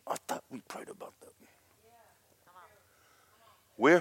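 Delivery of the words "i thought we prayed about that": quick, very soft whisper; nose-to-nose rebuke. Retold or imitated. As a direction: imitate a hushed, pointed reproach. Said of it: "put his little nose on mine".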